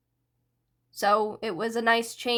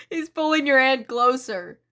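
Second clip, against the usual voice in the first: laughing; 2 semitones higher, 5 dB louder than usual. Acted. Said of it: "Spinner started laughing".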